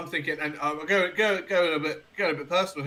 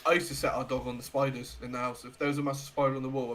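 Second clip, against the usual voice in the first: ordinary delivery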